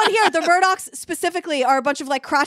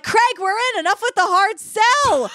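high, drawn-out mock plea; mock exasperation; 8 semitones higher, slower than usual. Co-host to TV host overselling the story, mock exasperated. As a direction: playfully cut off an overselling pitch.

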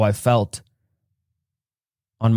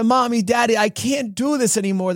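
put-on child crying out; 9 semitones higher than usual. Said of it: "And I came home one day crying".

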